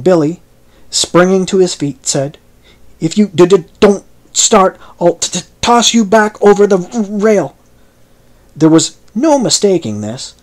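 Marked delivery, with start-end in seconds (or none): 3.0-7.6 loud stuttering threat, slowed; furious stammer. Acted. Billy on his feet threatening the stranger, loud stuttering threat. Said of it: "his vocal infirmity somewhat intruded".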